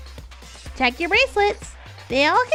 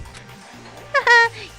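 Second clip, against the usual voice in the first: announcer laugh; 12 semitones higher, 10 dB louder than usual. Acted. Announcer laughs mid-speech.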